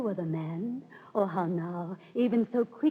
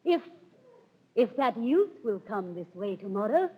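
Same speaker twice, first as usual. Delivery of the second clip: ordinary delivery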